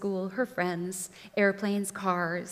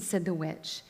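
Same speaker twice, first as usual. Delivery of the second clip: usual speaking voice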